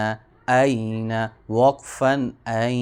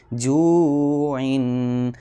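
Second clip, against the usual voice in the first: جوعن drawn out very slowly; slow spelling demo; slow joined reading; much slower than usual. Shown as a demonstration. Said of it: "عین دو زیر عین،".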